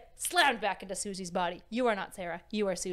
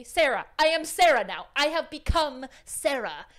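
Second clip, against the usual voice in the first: loud, raised self-announcement; hammed-up loud transformation; mock-grand declaration; 4 semitones higher, 8 dB louder than usual. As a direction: proclaim the new identity with drawn-out emphasis. Acted embracing of false identity.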